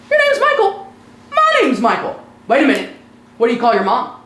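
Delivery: high, loud, astonished discovery; mock amazement. Dad joking with a little boy, mock amazement.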